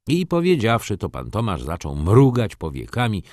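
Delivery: ordinary delivery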